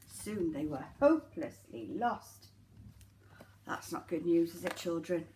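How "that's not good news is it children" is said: usual speaking voice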